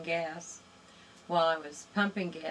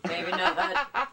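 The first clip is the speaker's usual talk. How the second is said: laughing; 2 semitones higher, 6 dB louder than usual. Real, not acted. Echoing host's joke with a laugh.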